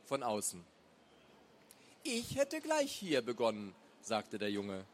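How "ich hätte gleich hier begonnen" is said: lightly voiced boy, pitched up; know-it-all kid's higher voice; precocious boy. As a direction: offer it as a young smart aleck.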